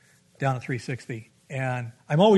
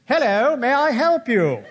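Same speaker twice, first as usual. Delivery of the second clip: mock Putin-like stranger; 9 semitones higher, 5 dB louder than usual. Devil figure greets him.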